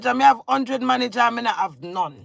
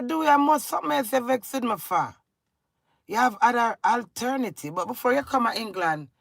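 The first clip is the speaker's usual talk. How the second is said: ordinary talk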